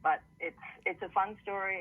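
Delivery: normal talking voice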